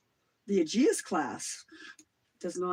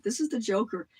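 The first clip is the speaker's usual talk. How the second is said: ordinary delivery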